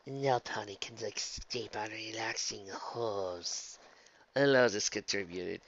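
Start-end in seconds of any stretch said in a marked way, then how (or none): 0.1-3.8 soft, slow, ominous purr; calm menace. Acted. Ella warning the fleeing Luna, calmly menacing. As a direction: intone it slowly and quietly.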